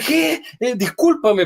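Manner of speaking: high, startled apology; bewildered. Retold or imitated. Guest thinks he's being mocked, high and startled. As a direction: stammer it out, thrown.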